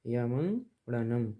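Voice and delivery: यमंगड़नम again slow and even; slow careful reading. Shown as a demonstration. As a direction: model यमंगड़नम for students. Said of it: "इसको ऐसे पढ़ें".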